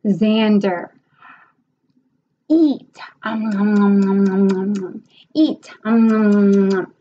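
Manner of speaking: put-on playful eating sounds for a child; teacher demo. Shown as a demonstration. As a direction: mime munching with nom noms. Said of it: "demonstrating eating prompt".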